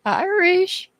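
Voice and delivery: high singsong call into the room. Real, not acted. Calling out for absent viewer.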